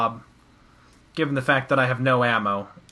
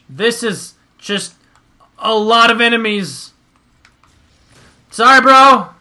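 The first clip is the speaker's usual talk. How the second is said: startled loud cry; 7 semitones higher, 10 dB louder than usual. Real, not acted. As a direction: yell out under heavy fire. Overwhelmed, shouting.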